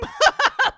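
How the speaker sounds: high burst of laughter. Real, not acted. Laughing at fans' reaction.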